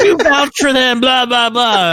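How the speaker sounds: high, hammed-up tearful rant; mock wailing. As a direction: imitate a wailing woman blaming someone. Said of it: "she's still wailing and screaming".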